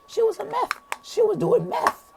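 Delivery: very high, softened squeal; squeaky mock voice. Telling friends she really was a crackhead, squeaky falsetto.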